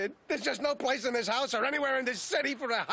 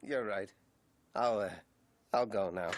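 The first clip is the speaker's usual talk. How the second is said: very low, softer, defeated; dejected surrender; 3 dB quieter than usual. Acted. Detective accepting his partner's order to leave, dejected. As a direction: give in, sunk low and small. Gives in after being scolded.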